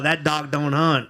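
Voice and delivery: a slow, folksy Southern drawl done as a mock quote; a teasing imitation of a Southern drawl. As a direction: drawl it slowly like an old Southern lawyer. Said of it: "He's that old Southern drawl".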